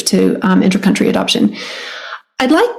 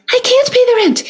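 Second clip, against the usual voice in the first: high, far-off squeaky damsel voice; damsel in distress. Acted. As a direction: plead as a helpless melodrama heroine. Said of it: "a victim".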